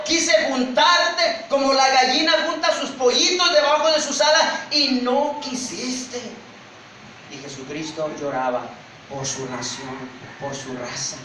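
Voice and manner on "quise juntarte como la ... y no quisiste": high, slow, impassioned cry; loud plea stretching out at the end; anguished lament. Acted. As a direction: pour out grief and reproach. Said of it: "comenzó a llorar y dijo".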